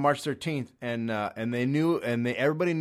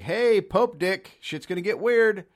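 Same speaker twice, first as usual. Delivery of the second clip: cocky mock announcement; 9 semitones higher, 8 dB louder, slower than usual. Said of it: "Just like".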